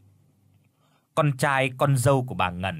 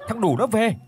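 panicked cry; 9 semitones higher than usual. Acted. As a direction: shriek it in terror. Terrified villagers cry ghost is back.

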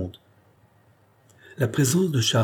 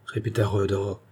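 usual speaking voice

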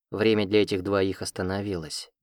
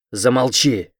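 loud, threatening bark; threatening growl; 3 semitones higher, 8 dB louder, slower than usual. Acted. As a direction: shut her up with a loud growl. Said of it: "угрожающе прорычал мужчина".

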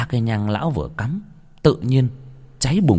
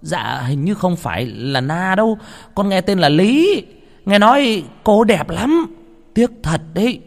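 naive, lilting and louder toward the name; regretful servant gossip; 10 semitones higher, 4 dB louder than usual. Acted. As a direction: gush over the girl with pity. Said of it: "thằng nô vừa chép miệng tiếc nuối".

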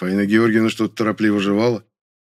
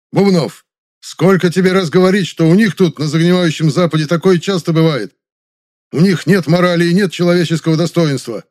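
loud, high angry lecture; barking; 9 semitones higher, 8 dB louder than usual. A narrator's reading. Ivanov lecturing Bubnov about the decadent West, barking. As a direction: bark a reprimand at full voice. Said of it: "и рявкнул".

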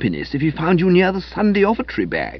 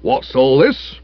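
stern, gruff police challenge; a constable demanding an explanation; austere constable; slower than usual. Acted. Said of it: "and he spoke austerely".

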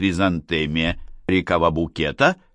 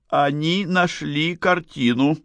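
high, slow, held-back distress; forced self-control; 8 semitones higher, slower than usual. A narrator's reading. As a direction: hold yourself together, word by word. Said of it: "пыталась взять себя в руки". (еле сдерживаясь, медленно и высоко)

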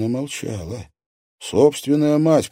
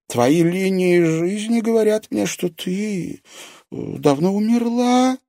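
put-on higher, ominous old woman voice; old fortune-teller; 12 semitones higher, 4 dB louder than usual. A narrator's reading. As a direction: reveal it like a grim seer. Fortune-teller reads palm.

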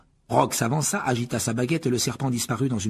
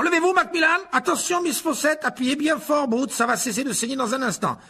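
high, loud busybody teacher; fussy professor; 4 dB louder than usual. A narrator's reading. Lockhart tends the wounded students, high and fussy. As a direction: bustle about the fallen students, pitched very high. Lockhart fussing over duel victims.

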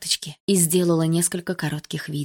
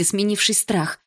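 plain reading voice

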